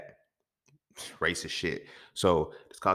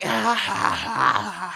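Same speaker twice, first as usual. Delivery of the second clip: mock laugh; 12 semitones higher, 5 dB louder, much slower than usual. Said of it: "the way he was laughing was purposely".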